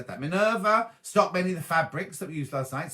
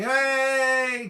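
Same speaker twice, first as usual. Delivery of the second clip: long, high, loud hooray; loud cheer; 9 semitones higher, 6 dB louder than usual. Real, not acted. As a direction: cheer them on at full voice. Cheering a viewer's shop opening.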